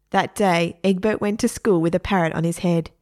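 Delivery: plain reading voice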